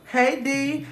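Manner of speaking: high-pitched breezy mock greeting; casual, as if nothing's wrong. Friend strolling back in after staying out, mock casual. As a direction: imitate a nonchalant hello. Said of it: "like wasn't nothing wrong".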